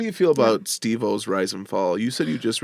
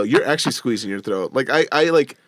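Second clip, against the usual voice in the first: normal talking voice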